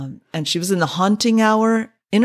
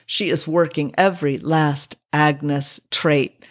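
normal talking voice

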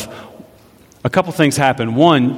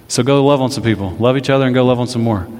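ordinary talk

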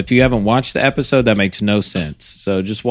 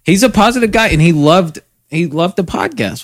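excited; 8 semitones higher, 4 dB louder than usual. Real, not acted. Echoing cohost, excited.

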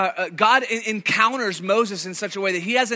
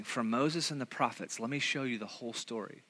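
softer, gentle teacher voice as Jesus; quiet Jesus voice; 7 semitones lower, 12 dB quieter than usual. Retold or imitated. Jesus walking with his friends, speaking softly.

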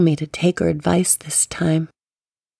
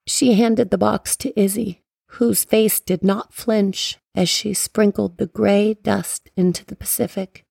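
plain reading voice

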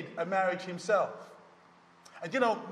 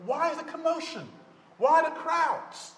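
a lightly voiced, higher curious blind man; blind man asking; 9 semitones higher than usual. A blind man asking a bystander, lightly higher. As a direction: ask the crowd what's going on, a bit higher.

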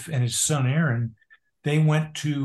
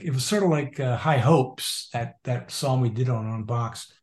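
usual speaking voice

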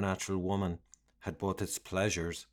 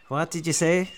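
thug challenge; 8 semitones higher, 7 dB louder than usual. A narrator's reading. Skinhead challenging the doctor.

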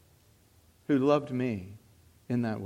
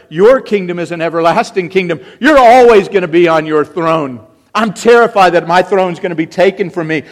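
loud, driving sermon voice, rising; fervent preaching; 6 semitones higher, 15 dB louder than usual. Acted. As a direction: cry it out to the congregation. Preaches at full force.

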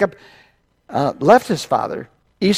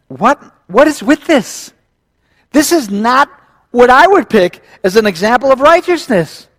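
higher, louder exclaimed disbelief; mock incredulity; 8 semitones higher, 6 dB louder than usual. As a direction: protest the story in raised, baffled disbelief. Preacher reacts incredulously to the story.

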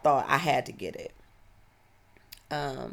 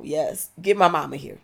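insistent louder; 9 dB louder than usual. Real, not acted. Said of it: "You know".

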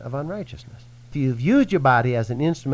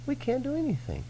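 mock whine; 13 semitones higher, 7 dB quieter than usual. Mock whiny high repeat of phrase.

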